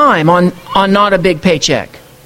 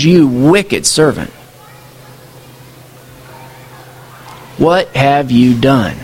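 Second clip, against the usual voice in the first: lower, slow, heavy verdict; grave master; 7 semitones lower, slower than usual. Acted. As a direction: drop low and deliver the sentence slowly.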